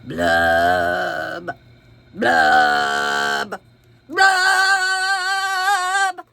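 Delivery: gloomy fish bubbling. Acted.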